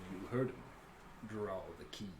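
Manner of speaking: low, quiet pirate captain; Captain Dreadfoot. Acted. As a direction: give a low, quiet order. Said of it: "Dreadfoot looks to, uh, Captain Isaac".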